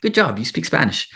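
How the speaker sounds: high-pitched imitation of encouraging locals; mock polite praise. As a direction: coo the compliment way up high. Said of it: "They say".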